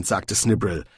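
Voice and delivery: ordinary delivery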